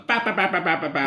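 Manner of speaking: fanboy babbling. Retold or imitated. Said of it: "just imagine I'm".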